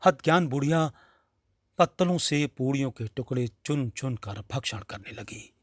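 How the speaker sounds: ordinary delivery, nothing marked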